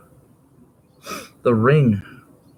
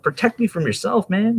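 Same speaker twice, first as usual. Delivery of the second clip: higher pleading voice; hobbit plea; 10 semitones higher than usual. Retold or imitated. Retelling Lord of the Rings, lightly voiced plea. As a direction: beg to be protected, a touch raised. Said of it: "It's like".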